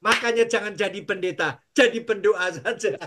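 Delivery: usual speaking voice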